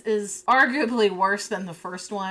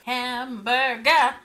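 announcer voice; 5 semitones higher, 3 dB louder, slower than usual. Acted. Announcer-style outro sign-off.

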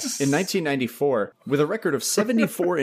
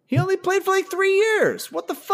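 can't believe it, pitch soaring; incredulous. Real, not acted. Stunned by his short career.

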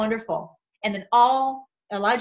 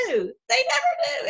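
much higher, slow, laughing through the words; 14 semitones higher, slower than usual. Real, not acted. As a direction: crack up while saying it. Laughing at the critics.

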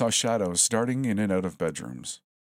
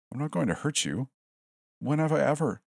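high hurt voice near tears; plead, voice going high; 9 semitones higher than usual. Acted.

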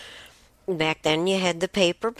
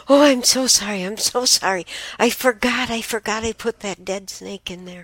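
higher, louder frantic apology as dad; father running out to his scared kid, higher, louder mock-frantic; apologetic father; 7 semitones higher, 6 dB louder than usual. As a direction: play the father rushing out, sorry.

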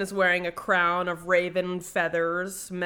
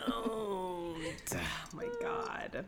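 embarrassed whisper; 2 semitones higher, 8 dB quieter, much slower than usual. Real, not acted. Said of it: "reacting embarrassed".

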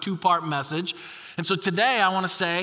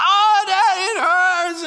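put-on much higher, louder little girl wail; shrill little-girl cry of pain; child crying out; 12 semitones higher, 7 dB louder, slower than usual. Dad imitating daughter as splinter comes out, high wail. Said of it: "she's like".

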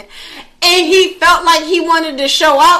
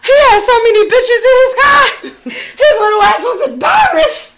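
laughing; 8 semitones higher than usual. Real, not acted. Laughing at friend.

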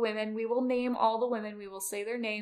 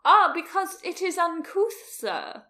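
higher, louder hoity-toity protest, dropping on the last word; mock-genteel outrage; 7 semitones higher, 8 dB louder than usual. Co-host playing offended gentry over spitting at the opera, mock-genteel outrage. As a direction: act grandly affronted by bad manners. Plays offended gentility at the law.

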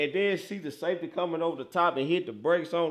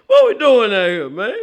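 exasperated loud call; 5 semitones higher, 10 dB louder than usual. Real, not acted. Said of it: "repeats it quieter".

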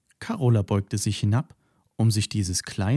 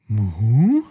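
loud two-note moo rising at the end; a lost cow asking; questioning moo; 7 dB louder than usual. Acted. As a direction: call out to the flower, confused. Cow searches for missing flower.

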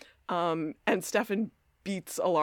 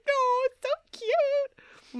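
drawn-out, very high coo; slow squeal of delight; high-pitched gushing; much slower than usual. Real, not acted. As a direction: coo over something adorable, stretching it out. Gushes over the romantic chase.

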